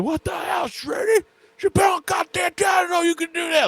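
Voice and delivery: high, loud mock yell at the student; imitating coach yelling. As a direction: bark it out like a fired-up wrestling coach. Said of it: "just starts yelling at me".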